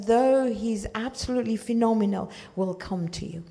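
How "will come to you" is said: ordinary delivery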